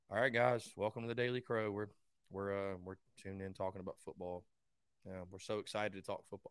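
mock subdued, low and soft intro; low-energy host. Host mocking a dull sports show intro, low-energy and subdued. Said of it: "I can't imagine being like".

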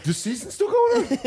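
very high-pitched mock surprise; imitated disbelief. Rested team after two weeks off, mock disbelief. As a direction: squeak it in disbelief, amused. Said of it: "They're like".

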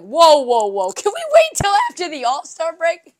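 loud, mocking whoa-whoa plea; sarcastic imitation of a coach crying for a delay; mock Doc Rivers. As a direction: ham up a panicked coach begging for time. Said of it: "the dude ... was like".

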